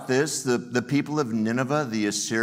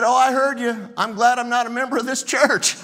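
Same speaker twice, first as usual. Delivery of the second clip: amused churchgoer; 13 semitones higher, 6 dB louder than usual. Retold or imitated. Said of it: "He said".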